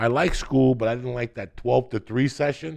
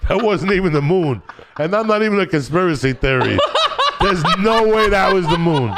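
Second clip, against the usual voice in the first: laughing disbelief; 7 semitones higher, 4 dB louder than usual. Real, not acted. Incredulous rant about moon landing.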